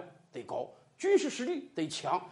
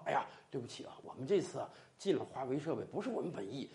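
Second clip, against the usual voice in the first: hushed, much softer confidential murmur; whispered aside; 9 semitones lower, 11 dB quieter, much faster than usual. Retold or imitated. British insider apologising secretly to China, hushed and soft. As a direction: lean in and confess quietly. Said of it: "放悄悄话".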